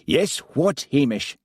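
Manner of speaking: a lightly voiced superintendent barking a correction; barked. Superintendent Daviot correcting Hamish, mild bark. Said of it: "barked Daviot".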